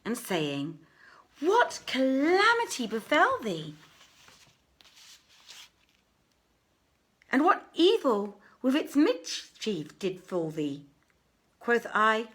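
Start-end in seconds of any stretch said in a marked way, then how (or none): 1.4-10.8 worried family. Acted. Family asks what befell him.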